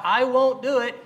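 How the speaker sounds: insistent scorpion promise. Retold or imitated. Scorpion's false promise retold.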